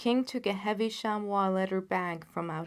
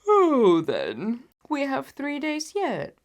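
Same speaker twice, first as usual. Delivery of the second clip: yawning; 6 semitones higher, 11 dB louder, slower than usual. Acted. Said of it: "said Holmes with a yawn".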